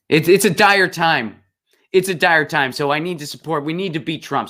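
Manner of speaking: high-pitched, sarcastic urgency; hammed-up solemn concern; mock alarm. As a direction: parody a celebrity's earnest political plea. Mocking impression of [name]'s political plea.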